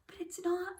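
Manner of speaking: secret whispered aside; storyteller whispering a warning to the children. Shown as a demonstration. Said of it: "whispered aside to listeners".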